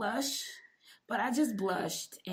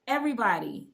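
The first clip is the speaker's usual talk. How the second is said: ordinary talk, nothing marked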